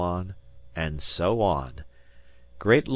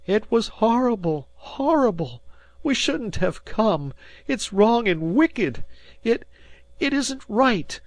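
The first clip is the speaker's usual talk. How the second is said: much higher, louder outcry; indignant cry; 14 semitones higher, 4 dB louder than usual. A narrator's reading. Mother to Shane about her fainted boy, indignant cry. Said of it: "she cried indignantly".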